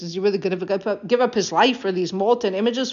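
normal talking voice

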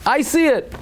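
fervent repeat. Retold or imitated. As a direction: insist on it, voice soaring up. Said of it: "the Chafetz Chaim says".

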